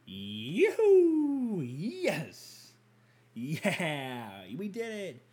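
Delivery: high, put-on celebratory voice; mock cheering. Gym-goer mocking we're all in this together, falsetto cheer.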